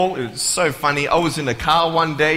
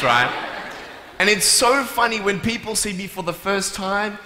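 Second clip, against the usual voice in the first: ordinary talk, nothing marked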